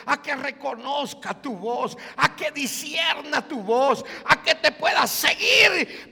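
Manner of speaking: praying aloud for open ears; prayer building to a shout. Acted. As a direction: build the plea to a climax.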